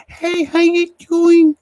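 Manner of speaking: put-on a tiny, high little-girl hello; Miss Chalet's talking voice. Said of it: "she talks like this".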